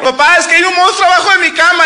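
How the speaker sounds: put-on high whiny child; pleading like a scared five-year-old; lightly voiced scared daughter; little girl voice. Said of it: "Y me habla".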